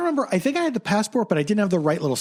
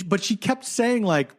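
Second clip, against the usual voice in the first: ordinary talk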